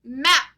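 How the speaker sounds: "Map" very loud, final "p" popped; loud, isolated "Map" with a released "p"; final P sound. Shown as a demonstration. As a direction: call out "Map" and puff the "p". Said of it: "There's our P again".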